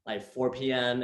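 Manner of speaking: ordinary talk, nothing marked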